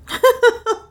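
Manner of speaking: high, loud burst of laughter. A narrator's reading. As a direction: Leonard laughs it off.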